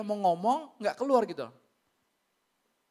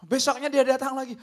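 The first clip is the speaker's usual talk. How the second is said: usual speaking voice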